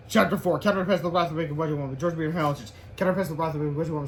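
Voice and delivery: ordinary delivery, nothing marked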